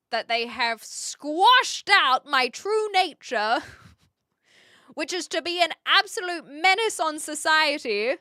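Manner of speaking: slow, loud hammy menace; mock villain. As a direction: ham up the evil reveal.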